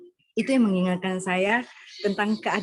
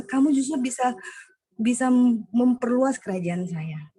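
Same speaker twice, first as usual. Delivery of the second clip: voice of God; 5 semitones higher, 3 dB louder than usual. Retold or imitated. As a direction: speak as God reassuring his child. Voicing God speaking to her.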